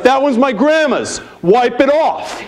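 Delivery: put-on high excited kid voice; proud kid. Kid pointing out grandma's car, high and proud.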